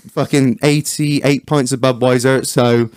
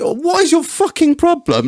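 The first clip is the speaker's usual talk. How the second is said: exasperated retort; 12 semitones higher than usual. Retold or imitated. Confronting the know-it-all man.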